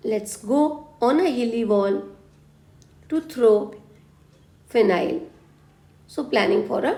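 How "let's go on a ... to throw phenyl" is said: slow mnemonic recap. Shown as a demonstration. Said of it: "मैं एक trick दे रही हूँ".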